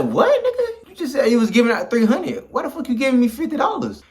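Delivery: very high-pitched retold protest; outraged disbelief. Player protesting fifty dollars instead of three hundred, high incredulous outrage.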